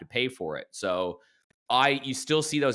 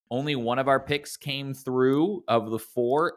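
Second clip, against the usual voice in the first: ordinary talk, nothing marked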